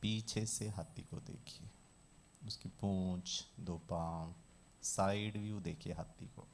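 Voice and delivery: low, soft, measured instruction; guided visualization. Shown as a demonstration. Teacher guiding a class to picture an elephant, calm visualization voice. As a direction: guide listeners gently through each picture.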